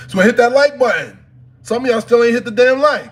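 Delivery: high, loud nagging demand; loud call-out. Real, not acted. Streamer demanding viewers hit like, loud scolding.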